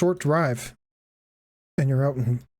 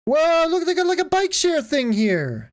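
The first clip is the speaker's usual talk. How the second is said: excited; 12 semitones higher than usual. Real, not acted. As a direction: point it out thrilled. Sees bike share.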